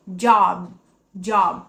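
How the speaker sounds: "Job" with the lips shut at the end, no pop; closed final b. Shown as a demonstration. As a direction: close it gently, don't force the end. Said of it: "Tem que fechar". ("Job" com os lábios fechados, sem forçar)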